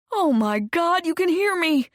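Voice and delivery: high, loud delighted cry; overjoyed. Acted. Sean thrilled that Zoe can hear him, high and loud. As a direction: burst out with relief and joy.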